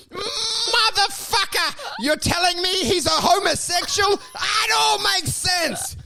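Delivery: high-pitched, drawn-out mock amazement; astounded gossip. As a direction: exclaim it like the juiciest revelation ever. Acts out phone call sharing gossip.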